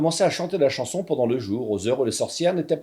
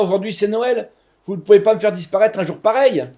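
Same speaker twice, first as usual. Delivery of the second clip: higher, louder desperate begging; pleading; 7 semitones higher, 5 dB louder than usual. Acted. Narrator on his knees begging the witch, higher and louder. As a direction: beg for mercy on your knees. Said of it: "je me mets à supplier".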